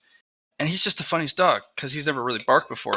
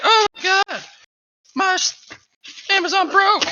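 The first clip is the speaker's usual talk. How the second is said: mocking panic, very high and louder; panicking customers; 4 dB louder than usual. Streamer mocking people panicking over the outage, high squealing panic. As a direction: squeal like a panicked shopper whose order broke. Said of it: "people lost their ever-living minds 'cause they're like".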